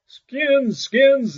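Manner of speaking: high, loud taunting echo; mocking. A narrator's reading. Townsfolk mocking Great Claus's cry, taunting. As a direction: throw his cry back at him in scorn. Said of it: "they mocked him".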